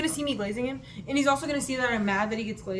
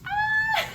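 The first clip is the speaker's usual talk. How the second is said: very high joyful squeal; short high-pitched squeal of delight; excited squeal; 5 dB louder than usual. Real, not acted. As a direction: let out a giddy shriek. Squeals recalling the win.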